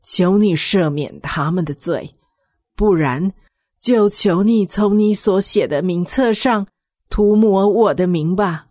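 earnest plea. Acted. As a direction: plead humbly and earnestly. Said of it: "诚恳地对上帝说".

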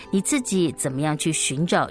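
plain reading voice

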